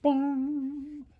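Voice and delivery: high, loud ringing bong; bright vocal sound effect; sung bong. Acted. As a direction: chime it out like a bell.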